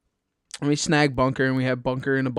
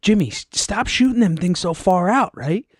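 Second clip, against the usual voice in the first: retold yell; 5 semitones higher, 4 dB louder than usual. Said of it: "he says".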